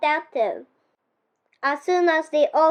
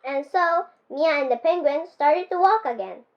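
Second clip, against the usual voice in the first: plain reading voice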